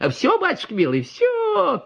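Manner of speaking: very high, lilting and sweet; sing-song peasant woman. A narrator's reading. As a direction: sing it out to reassure the doctor. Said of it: "пела бабочка".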